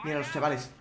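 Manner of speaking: soft, low hushed pointing out. Real, not acted. Gamer hiding and showing viewers the enemies, hushed. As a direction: murmur so the enemies can't hear.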